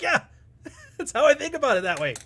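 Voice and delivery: amused, half-laughing quote. Acted. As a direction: giggle out the realization. Laughing while voicing the husband.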